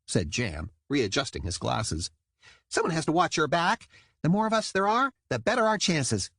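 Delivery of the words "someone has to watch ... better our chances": high, crisp dutiful report; eager dolphin soldier. A narrator's reading. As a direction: rattle it off like a keen cadet. Jam insisting.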